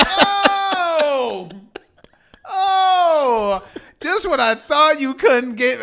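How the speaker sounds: high, loud, slow choked-up wail; mock emotional wail. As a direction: overcome watching the movie, laughing at himself.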